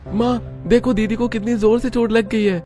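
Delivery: put-on high child voice calling to mother; a boy rushing in to tell mom. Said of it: "क्यों इतनी जोर से चिल्ला रहे हो". (ऊँची आवाज़ में माँ को पुकारता बच्चा)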